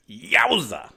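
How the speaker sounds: loud, high comic yelp; yowza exclaim. A narrator's reading. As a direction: pop it out like a cartoon gag. Narrator exclaims at the pain.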